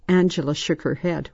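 ordinary delivery

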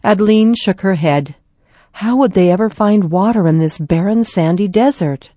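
ordinary delivery, nothing marked

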